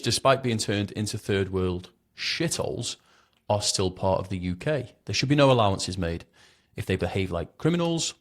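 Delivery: ordinary delivery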